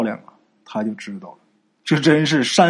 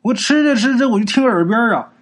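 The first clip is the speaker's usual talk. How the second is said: ordinary delivery, nothing marked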